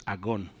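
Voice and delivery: agón stressed on the final syllable ón; corrected accent. Shown as a demonstration. Pastor correcting his Greek, stressing the last syllable. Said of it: "falta un acento aquí".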